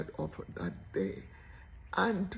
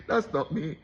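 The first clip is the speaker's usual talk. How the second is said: ordinary delivery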